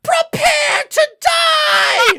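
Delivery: high, loud, drawn-out imp war cry; theatrical threat. Acted. GM as the Ymira actor pointing out, hammy war cry. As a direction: stretch it into a hammy squealed shout. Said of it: "points out and goes".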